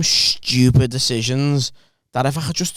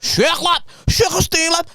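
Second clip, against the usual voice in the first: much higher, put-on broken English accent; imitating Kurdish kid; slower than usual. Mocking his old Kurdish coworker's broken English accent. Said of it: "Kurdish kid, couldn't speak a word of English".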